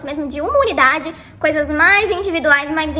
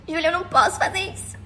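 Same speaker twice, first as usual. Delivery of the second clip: softer, whimpery confession; mock tearful man; 5 dB quieter than usual. Narrator quoting the crying guy's confession, mock tearful. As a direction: play his weepy guilt, half mocking. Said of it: "com voz de choro".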